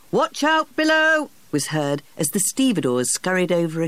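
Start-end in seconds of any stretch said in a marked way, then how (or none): 0.1-1.3 warning shout. A narrator's reading. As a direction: yell a warning down the hold. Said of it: "a warning shout of".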